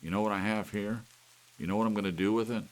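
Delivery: loud, high menacing taunt; man with hammer taunting the cuckoo; gloating threat. A narrator's reading. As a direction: savour the threat. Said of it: "he smiled".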